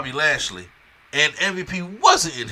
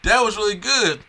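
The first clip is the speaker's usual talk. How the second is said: raised, drawn-out praise with extra volume; imitated hearty approval; 7 semitones higher, 5 dB louder, slower than usual. Imitating Lesnar praising MVP, high drawn-out approval. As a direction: stretch out the impressed praise, quoting someone. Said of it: "And Lesnar gets up. He's like".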